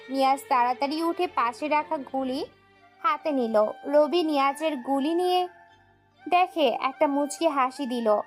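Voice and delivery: ordinary delivery, nothing marked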